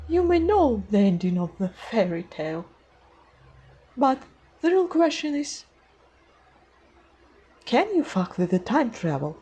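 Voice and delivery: amateur attempt at a storybook queen; Queen of Hearts. Shown as a demonstration. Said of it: "I made my voice higher".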